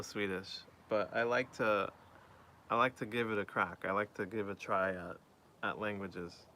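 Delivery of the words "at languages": normal talking voice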